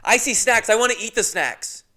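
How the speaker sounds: put-on high, loud little boy voice; imitating the kid. The kid announcing the snacks to the room, high and loud. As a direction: whine it like a greedy nine-year-old. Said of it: "that's how he talks".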